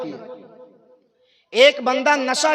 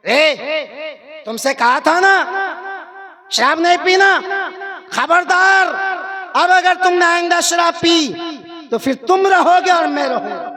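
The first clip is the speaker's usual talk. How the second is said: डांटते पिता; 5 semitones higher than usual. Retold or imitated. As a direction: warn him off like a furious dad. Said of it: "डांटेगा". (ऊंची, तेज़ आवाज़ में डांटते हुए)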